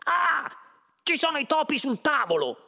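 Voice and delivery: high, loud alarmed squeal; startled shriek. A narrator's reading. Arthur spots mice on the table, startled high shriek. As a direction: shriek in fright. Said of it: "fece uno strillo".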